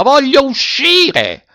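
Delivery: long-drawn, very high yell; frantic trapped man, slow high shout; furious shout. A narrator's reading. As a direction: drag out the demand in a high cry. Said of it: "gridò Zi Dima".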